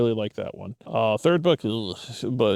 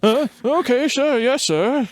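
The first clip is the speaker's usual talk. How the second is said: high meek mock compliance; 11 semitones higher, 4 dB louder than usual.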